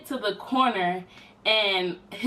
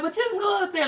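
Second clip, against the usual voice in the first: breezy sister; 12 semitones higher, faster than usual. Retold or imitated. Voicing the sister's casual invite.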